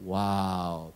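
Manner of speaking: ordinary talk, nothing marked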